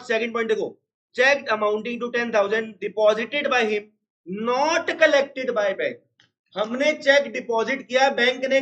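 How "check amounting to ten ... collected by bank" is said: each word drawn out for writing; slow dictation. Shown as a demonstration. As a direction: dictate it slowly to the class. Dictating the statement. (छात्रों को धीरे-धीरे लिखवाना)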